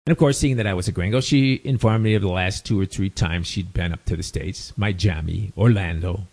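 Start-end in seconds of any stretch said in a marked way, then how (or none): 4.8-6.3 Miami said lightly Latin, as "my-JAH-mee"; Latin American pronunciation. Retold or imitated. Doctor listing her trips to the States, Latin accent. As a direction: give Miami a slight Latin accent, with a j sound. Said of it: "you'll hear Latins down here saying".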